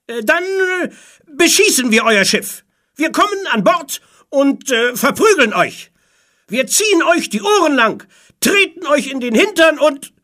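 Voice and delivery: high, loud, hesitant pirate threats; shouted threats. A narrator's reading. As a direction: shout threats across, searching for words. Pirate shouts threats to other ship. (lautes, stockendes Drohen)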